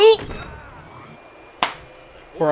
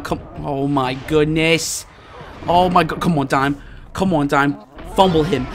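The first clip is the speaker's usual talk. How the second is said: ordinary talk, nothing marked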